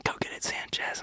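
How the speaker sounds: hushed, breathy urging from the wings; low whispered nudge to go on stage; whispered cheer. Retold or imitated. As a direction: whisper it like a secret pep talk. Retelling a friend's hushed encouragement.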